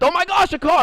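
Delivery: high-pitched gush of hammed-up excitement; mock being thrilled, pitch leaping way up; squealy imitation of getting too excited; mock overexcitement. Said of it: "I got too excited and shit".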